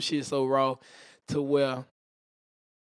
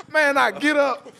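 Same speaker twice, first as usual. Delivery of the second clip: ordinary talk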